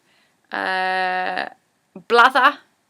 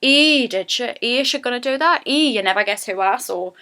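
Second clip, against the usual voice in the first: lightly voiced Geordie girl gossiping; chatty Geordie lass gasping at news; 3 semitones higher than usual. Shown as a demonstration. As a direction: Geordie girl trading gossip with her friend. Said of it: "That is just a Geordie girl phrase".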